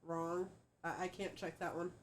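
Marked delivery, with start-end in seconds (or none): none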